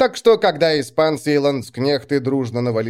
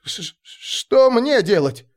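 slow, high, stammering fright; stammering panic; 9 semitones higher, slower than usual. Acted. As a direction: stutter it out in panic.

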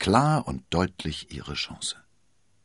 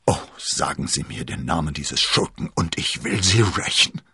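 groaning plea; 4 dB louder than usual. Acted. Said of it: "stöhnte er".

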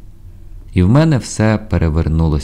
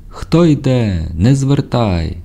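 high, long-drawn sentry hail; drawn-out call; 8 semitones higher, slower than usual. A narrator's reading. Unseen voice hailing a sailor through fog, drawn-out call. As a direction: stretch each word out like a shout through fog. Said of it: "я почув крізь туман крик".

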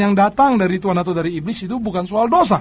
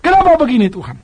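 complaining to God; 6 semitones higher than usual. Retold or imitated. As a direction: protest to God like a frustrated believer. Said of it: "jangan sampai saudara mempersalahkan Tuhan".

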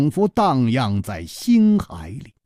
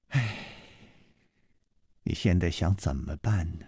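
gentle, softer question; 6 semitones lower, 9 dB quieter than usual. Acted. Su Rongrong gently asks Chu Liuxiang what he'll do now. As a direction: ask him tenderly and quietly. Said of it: "柔声说".

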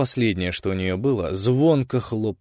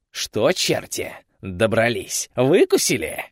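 mocking; 2 semitones higher, 3 dB louder, slower than usual. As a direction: taunt them with glee. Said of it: "издевательски захохотал Румифь".

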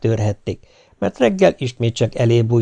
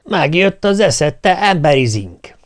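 higher, louder, slow giant's yell; old giant shouting; 6 semitones higher, 4 dB louder, slower than usual. Acted. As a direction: roar it like a mocking old giant. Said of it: "kiáltott az öreg óriás". (az öreg óriás lassú, hangos kiáltása)

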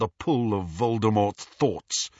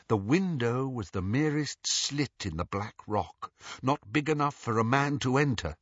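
ordinary delivery, nothing marked

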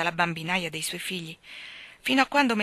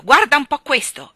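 loud, higher and indignant; angry; 7 semitones higher, 14 dB louder than usual. A narrator's reading. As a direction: shove it in her face, furious. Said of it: "egli ringhiò". (indignato, forte e acuto)

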